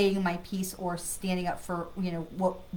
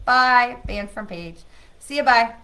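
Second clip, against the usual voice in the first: dismissive goodbye; 2 semitones higher, 10 dB louder than usual. Real, not acted. Banishing a spammer from the chat.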